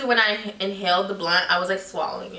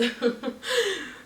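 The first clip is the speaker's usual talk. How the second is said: high, softer giggling burst; laughing; 9 semitones higher, 5 dB quieter than usual. Real, not acted. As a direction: giggle at your own slip-up. Laughs at her incomplete list.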